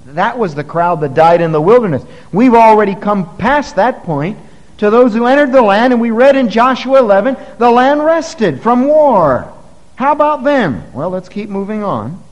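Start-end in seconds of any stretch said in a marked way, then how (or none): 0.1-10.8 louder, higher pulpit voice; preaching at full force. Acted. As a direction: drive it home from the pulpit.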